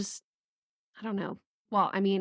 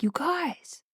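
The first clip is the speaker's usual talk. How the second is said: higher, called to a group; calling out; 8 semitones higher than usual. Shown as a demonstration. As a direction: get their attention.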